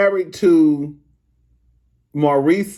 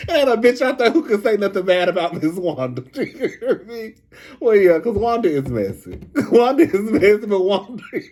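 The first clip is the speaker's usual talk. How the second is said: talking through rising giggles; real laughing fit; 4 semitones higher than usual. Real, not acted. Gossiping to viewers about messy Wanda, breaking into laughter. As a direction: try to praise her while cracking up. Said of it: "I can't even go".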